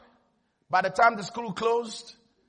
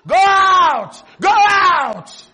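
chanted shout, much higher and louder; class screaming; 5 semitones higher, 11 dB louder, much slower than usual. Retold or imitated. Preacher acts out classmates pushing the boy outside, chanting shout. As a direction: yell it like a whole class egging on a fight. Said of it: "the whole class started screaming at him".

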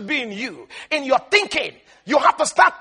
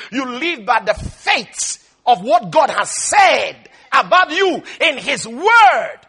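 ordinary delivery

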